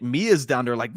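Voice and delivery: usual speaking voice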